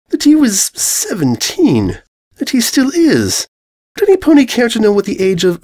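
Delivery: a fussy lady stating facts. Acted. Rarity's high prissy voice.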